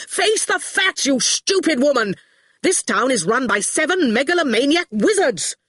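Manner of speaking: high-pitched acted yell. A narrator's reading. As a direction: yell it in exasperation. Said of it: "was yelling".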